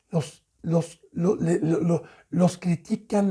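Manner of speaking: ordinary talk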